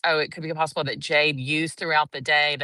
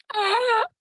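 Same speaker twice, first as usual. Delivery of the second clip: sharp imitated gasp, pitched very high; shocked gasp. Stepmom in the front row reacting, imitated gasp. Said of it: "the stepmom went".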